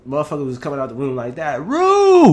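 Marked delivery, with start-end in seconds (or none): 1.6-2.3 high, loud barked name; drill sergeant shout. Retold or imitated. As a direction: yell the name across the barracks. Drill sergeant calling his name.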